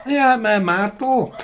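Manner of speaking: high, broad northern grandma voice; exaggerated imitation of grandma's northern accent; hammed-up broad northern English. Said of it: "Sorry, it's your broad accent".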